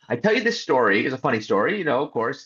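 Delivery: normal talking voice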